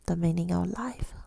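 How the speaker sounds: ordinary delivery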